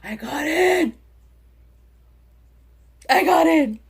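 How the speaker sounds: retold triumph when she gets into his account; excited. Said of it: "and I was like".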